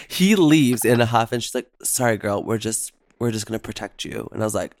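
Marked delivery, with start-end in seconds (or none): 1.8-4.3 soft, retold aside to a friend; confiding reassurance. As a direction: drop the volume and reassure her discreetly. Worker reassures the guest, retold.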